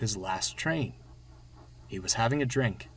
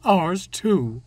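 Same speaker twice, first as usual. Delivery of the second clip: higher, louder, mildly mournful voice; sad; 7 semitones higher, 8 dB louder than usual. A narrator's reading. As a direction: chime in with a touch of misery. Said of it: "interrupted Annie sadly".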